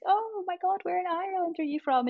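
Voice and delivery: high-pitched gushing imitation of an American; excited American. American meeting a Scot at camp, high excited mimicry. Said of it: "an American person be like".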